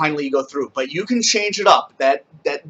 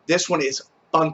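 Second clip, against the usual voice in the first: ordinary delivery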